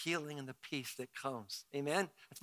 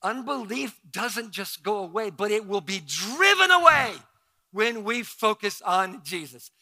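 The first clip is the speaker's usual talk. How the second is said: emphatic declaration; 5 semitones higher, 10 dB louder than usual. Acted.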